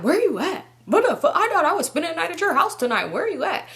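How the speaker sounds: high-pitched imitation of an irate boyfriend; mock angry demand. Boyfriend asking where she is tonight, mimicked anger. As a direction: demand answers in his indignant voice.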